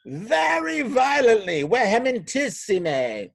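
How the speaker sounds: high, loud, slow vengeful villain; Epaphroditus. Acted. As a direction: draw out each word with theatrical menace. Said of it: "Epaphroditus impersonation".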